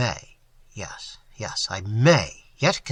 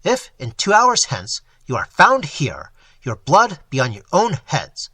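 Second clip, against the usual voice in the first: loud, menacing warning; menacing judge orders them gone; stern threat; 5 dB louder than usual. Acted. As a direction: drive them out with fury. Brandon threatens the confederates.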